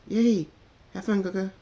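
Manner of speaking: high, loud joyful yay; excited cheer. Acted. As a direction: squeal with delight as you run off. Filly cheers as she leaves.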